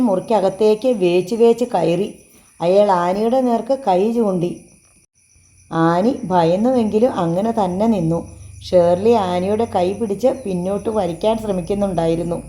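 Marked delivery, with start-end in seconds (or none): none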